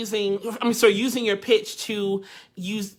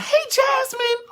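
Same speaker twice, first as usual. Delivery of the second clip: high, drawn-out hello; excited greeting; 3 dB louder, slower than usual. Real, not acted. Host spots a viewer mid-sentence, high excited greeting. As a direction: break off to call out a slow, high-pitched hello.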